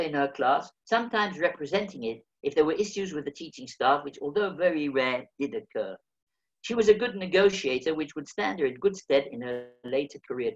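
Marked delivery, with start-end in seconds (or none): none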